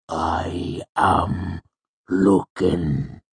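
drawn-out, thick words; befuddled mercenary labouring to speak; dazed and slow. A narrator's reading. Dazed mercenary forms words slowly.

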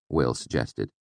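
usual speaking voice

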